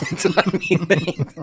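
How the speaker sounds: high mock Aussie line through laughter; giggly, high-pitched mimicry; laughing imitation. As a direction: echo the Aussie quote, cracking up. Echoing the quote while laughing.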